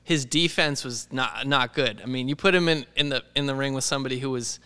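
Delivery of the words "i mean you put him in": ordinary talk, nothing marked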